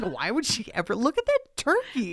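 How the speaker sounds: high, loud giddy delight; gushing excitement. Retold or imitated. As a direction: swoon over the feast. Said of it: "I was like".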